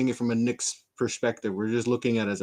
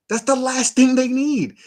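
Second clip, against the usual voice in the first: exclaiming; 13 semitones higher, 6 dB louder than usual. Real, not acted. Repeats the point, much higher.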